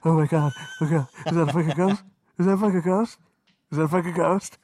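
loud, fast panicked cries into the mic; yelling in fake fright at the game controller; mock scared yelling. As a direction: ham up being terrified of a ghost. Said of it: "you can say whatever sound you want".

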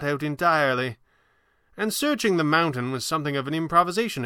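high, considered sorcerer's voice; old magician. Acted. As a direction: reason it out as the old showman. Voices Falcone.

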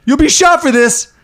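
high, slow, indignant threat; Christoph Waltz. Acted. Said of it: "it's Brad Pitt and Christoph Waltz".

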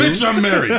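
high, loud brush-off; retold loud comeback. Married Marine rebuffs girl grabbing his arm, loud retort. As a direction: fire it back loudly for a laugh.

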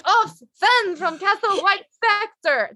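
high, slow, hammed-up French accent; terrible French accent. Imitating the late costume designer, exaggerated French accent. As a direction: do a cartoonishly bad French impression. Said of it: "doing the worst French accent".

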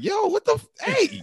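very high-pitched squawk; hammed-up squeal of disbelief; alarmed protest. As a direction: break into a high mock-outraged objection. Acts out alarmed protest at friend.

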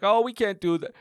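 lightly voiced man from the crowd; crowd guy's line. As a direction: quote the heckler with only a hint of imitation. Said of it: "that one guy's like".

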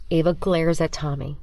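ordinary delivery

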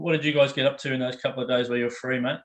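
ordinary talk

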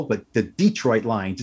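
"Detroit" with the stress on the first syllable, DEE-troit, drawn out slowly. Shown as a demonstration. Co-host showing the old-school way to say "Detroit": stress on DEE. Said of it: "You put the accent on the first syllable".

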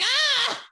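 sudden high yelp of pain. Pretend. Comic yelp from a painful massage, a sudden high ah. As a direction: yelp out as an elbow digs in. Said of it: "I'd be like".

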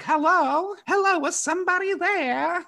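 high, mock French Canadian hello; imitating weird accent. Friend imitates Jess's French Canadian accent answering the phone. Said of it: "In her weird accent".

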